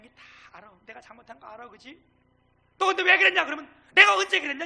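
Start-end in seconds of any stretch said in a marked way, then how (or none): 2.8-3.4 loud, high-pitched challenge; mock accusation. Acted accusation in a retort example. 3.9-4.6 loud, high defensive retort; reflexive denial. Acted. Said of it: "이게 반사적으로 나오는 거예요".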